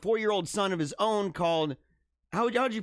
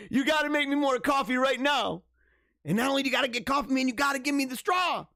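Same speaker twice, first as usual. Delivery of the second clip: high, hammed-up bossy outburst; mock demand; 7 semitones higher than usual. Boss demanding coffee and a straw from staff, mock demanding. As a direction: order them about in pretend outrage.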